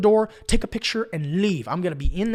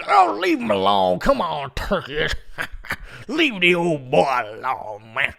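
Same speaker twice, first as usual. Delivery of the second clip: put-on drawled old farmer chiding through a chuckle; amused old farmer; slower than usual. Old farmer tells turkeys to leave driver alone, amused. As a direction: scold the birds lazily, half laughing. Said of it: "he starts talking to the turkeys".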